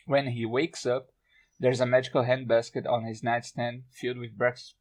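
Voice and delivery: ordinary talk, nothing marked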